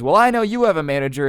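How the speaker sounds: mock indignant retort, high and loud; insistent customer. Acted customer arguing back.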